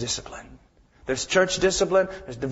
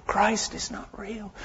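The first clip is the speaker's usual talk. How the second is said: hushed, slow whisper; whispered repeat; 2 semitones higher, 7 dB quieter, slower than usual. Shown as a demonstration. Preacher hushes the room on the repeated line, whispering. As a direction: breathe the words, barely voiced.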